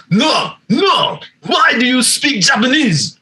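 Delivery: high-pitched, mock-indignant Japanese accent; Miyazaki kind of voice. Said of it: "fucking Miyazaki kind of voice".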